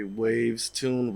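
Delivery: ordinary talk, nothing marked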